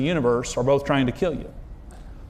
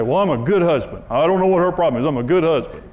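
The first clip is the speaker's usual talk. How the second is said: self-satisfied whiny protest; smug self-defense. Retold or imitated. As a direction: play the clueless husband who blames his wife. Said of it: "A lot of people say".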